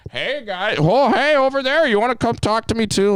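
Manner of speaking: very high, loud attention-seeker; mock calling out. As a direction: imitate someone hailing people across the bar.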